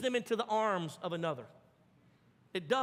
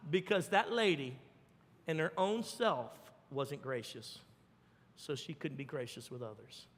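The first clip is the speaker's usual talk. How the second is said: ordinary talk, nothing marked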